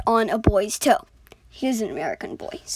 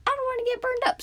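much higher, small character voice; squeaky coal voice; 13 semitones higher, faster than usual. Acted. Coal piece names himself in a high voice. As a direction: talk like a tiny lump of coal.

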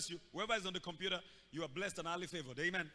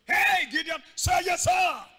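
high, loud prompt; shouted call out; 9 semitones higher, 13 dB louder, slower than usual. Real, not acted. Preacher urging the church to answer, shouting. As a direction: call it out to the crowd.

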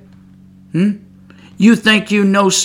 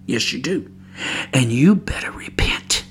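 hushed warning; 5 semitones lower, 8 dB quieter than usual. Real, not acted. Preacher's hushed warning to relatives.

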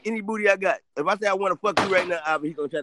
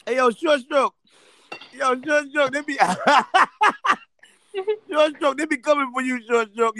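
high, giggly teasing call; laughing tease; 6 semitones higher, 3 dB louder, slower than usual. Real, not acted. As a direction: call out while cracking up.

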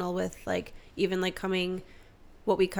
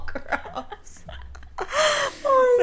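laughing; 5 dB louder, much slower than usual. Real, not acted. Laughing hard.

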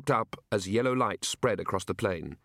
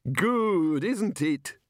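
high and pleased with himself; proud of his trick tower; happily; 8 semitones higher, much slower than usual. Acted. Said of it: "said the Arch-Chancellor happily".

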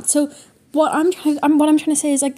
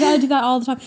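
usual speaking voice

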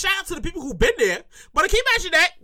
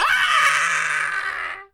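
long acted shriek; drawn-out scream of a swooning fan; mock hysterical scream; 3 semitones higher than usual. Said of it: "All he turned his head to like".

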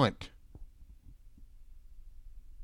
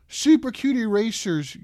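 normal talking voice

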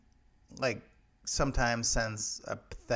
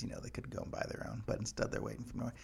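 ordinary talk

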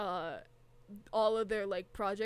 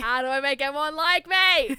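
quoting JFK; 3 semitones higher, 9 dB louder than usual. Retold or imitated. Imitating JFK line loudly.

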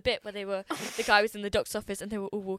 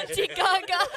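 Auntie Gaga called out, pitched up and punchy; louder, higher repeat; 8 semitones higher, 8 dB louder than usual. Pretend. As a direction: belt the name out for laughs.

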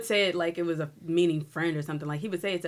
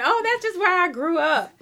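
high, louder mimicry of an excuse; mock them in a raised, exaggerated voice; 11 semitones higher, 7 dB louder than usual. Mocking an excuse for the accent.

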